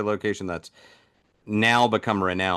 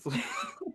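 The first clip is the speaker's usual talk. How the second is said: soft breathy laugh; 5 semitones higher, 12 dB quieter than usual. Real, not acted. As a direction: laugh quietly at your own bit. Laughs at own impression.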